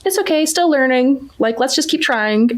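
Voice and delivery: put-on carefree little girl. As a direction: imitate a relaxed kid who isn't afraid of failing. Mom voices carefree daughter.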